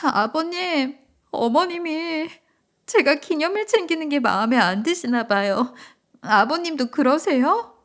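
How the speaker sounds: high, plaintive daughter-in-law; acted tearful. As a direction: appeal tearfully to your father-in-law. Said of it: "며느리는 갑자기 울음을 터뜨렸어요". (울먹이는 며느리 목소리)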